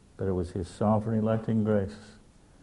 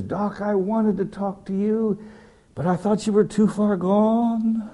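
high, slow, laughing through tears; high tearful confession; 10 semitones higher, slower than usual. Retold or imitated. Weeping friend confesses to the pilot, high and slow.

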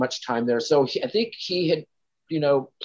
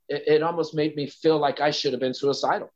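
ordinary talk

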